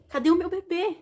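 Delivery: put-on a new mother suddenly fearing for her baby; retelling her panicked question to the nurse; anxious retold question. She wakes asking nurse for baby.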